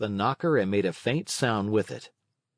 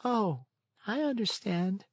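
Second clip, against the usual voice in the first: much higher, softer snail voice; 10 semitones higher, 4 dB quieter than usual. A narrator's reading. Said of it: "said the snail".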